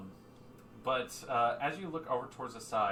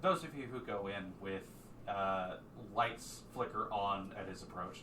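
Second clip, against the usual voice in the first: ordinary talk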